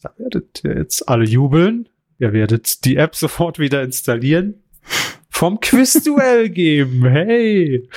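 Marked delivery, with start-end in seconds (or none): 5.3-7.9 mock excitement. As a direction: fake jubilation about the quiz. Sarcastic mock cheer.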